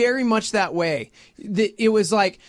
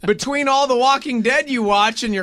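mock bewilderment; 3 semitones higher, 5 dB louder than usual. Friend teasing a father about son's fear, loud sarcasm. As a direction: play baffled with heavy irony.

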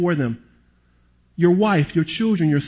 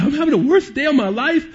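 distraught cry; 9 semitones higher than usual. Retold or imitated. Man breaks down crying.

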